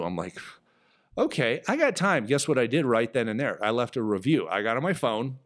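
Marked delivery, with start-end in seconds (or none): 1.2-2.3 high, bright eager yes; eager agreement. Retold or imitated. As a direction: jump up in pitch, happy to oblige. Agreeing to leave a review.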